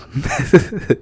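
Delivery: brief amused laugh breaking through; short laugh. Real, not acted. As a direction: chuckle at how absurd it is. Laughing mid history rant.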